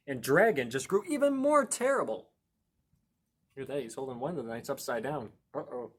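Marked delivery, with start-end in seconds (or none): 0.1-2.3 higher and louder, mock dramatic; dramatic build. A narrator's reading. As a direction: ramp it up like a cliffhanger. Building up dragon's terribleness.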